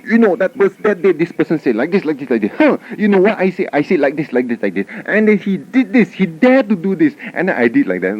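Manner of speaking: high, agitated imitation of a complainer; mock angry ranting. Angry relative cornering you to complain, mock ranting. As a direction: rattle off grievances in a high mock rant. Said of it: "sometimes they get so angry".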